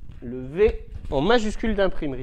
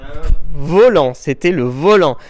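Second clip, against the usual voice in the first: "volant" said louder and slower; stressed volant; 3 semitones lower, 10 dB louder, slower than usual. Shown as a demonstration. Teacher confirming the answer, stressing the word. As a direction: lean on "volant" for the class. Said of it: "vous entendez volant".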